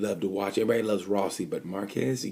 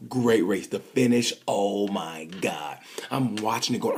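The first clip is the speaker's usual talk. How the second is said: ordinary talk, nothing marked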